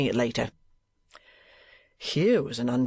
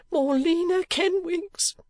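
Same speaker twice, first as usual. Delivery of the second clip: much higher, slow, gushing mother; gushing cry; slower than usual. A narrator's reading. Mother calls her daughter gushingly to thank the uncle. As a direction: call her daughter out in a gush. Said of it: "cried her mother in a torrent of affection".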